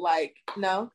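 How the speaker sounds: usual speaking voice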